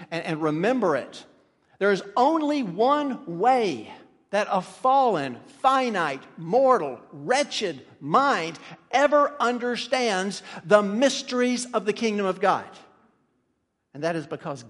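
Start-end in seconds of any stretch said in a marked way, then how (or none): 1.8-12.7 slow, punched pulpit declaration; emphatic preaching. Real, not acted. Preacher declaring a principle to remember, emphatic. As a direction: hammer each word home from the pulpit.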